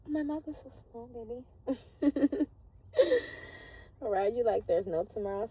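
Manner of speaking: high, loud playful sex talk with a squealing laugh. Retold or imitated. Woman teasing her lover in bed, hammed-up squeal. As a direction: ham up a giggling seductress.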